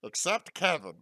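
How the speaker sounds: high, rough cartoon alien voice; Stitch voice. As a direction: growl it like Stitch. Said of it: "the Stitch voice".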